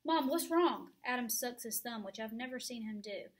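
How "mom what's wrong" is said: put-on scared child. Frightened child asks mother.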